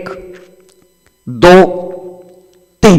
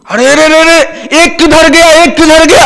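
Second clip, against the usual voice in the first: high, alarmed cry of a lost count; panicked counting; 7 semitones higher than usual. Acted. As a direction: panic as one friend seems gone. Friends panic at missing one. (घबराई हुई ऊँची पुकार)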